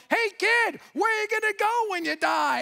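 high, loud mock shout across the park; hollering at the kid while running; eager shout. Doug running at a boy on a jungle gym, high mock shout.

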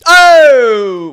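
high, loud, drawn-out wail; long pained cry in sympathy; horrified "oh". Real, not acted. Co-host hears it was kidney stones, horrified cry.

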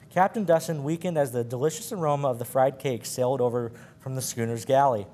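ordinary delivery

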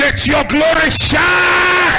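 shouted prophetic declaration, high and slow; sermon shout at the top of the voice; preaching at full force. Acted. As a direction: proclaim it over them with full force.

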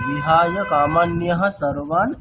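বিহায় কামান্যহা সর্বান read slowly in one breath; recited as one unit. Shown as a demonstration. Teacher showing which part is read as one. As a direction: read the whole quarter-verse together. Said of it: "এতটুকু অংশ আমাদের একসাথে পাঠ করতে হচ্ছে".

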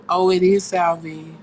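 ordinary talk, nothing marked

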